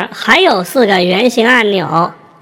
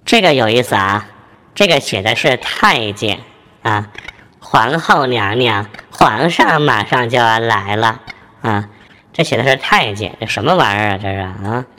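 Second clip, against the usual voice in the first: much lower, faster changer voice; pitched way down by the changer; eunuch voice effect; 9 semitones lower than usual. Shown as a demonstration.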